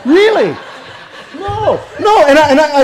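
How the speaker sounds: real amused laugh while confessing. Real, not acted. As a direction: laugh at yourself mid-sentence.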